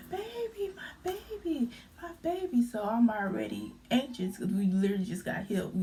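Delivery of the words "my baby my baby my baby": put-on high, wailing "my baby" over and over; imitating a distressed lady calling for her baby; frantic mother crying out. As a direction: cry out like a panicked mother for her child. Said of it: "ladies saying".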